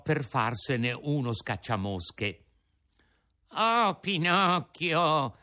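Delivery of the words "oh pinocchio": higher, slow, wheedling whine; whining fox. Acted. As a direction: beg like a sly fox. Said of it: "con voce di piagnisteo".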